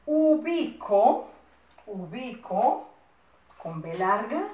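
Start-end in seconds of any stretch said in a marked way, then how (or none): 0.1-1.3 UBICO very slow, higher and louder; slow dictation. Shown as a demonstration. Dictating words to write down. 1.9-2.9 UBICO repeated slowly; slow, rising UBICO for pupils copying; slow dictation. Shown as a demonstration. Repeats the dictated word for writers.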